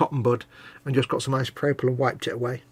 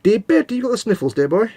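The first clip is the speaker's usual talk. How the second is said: put-on fussing; 8 semitones higher than usual. Put-on fussing over co-host's sniffing.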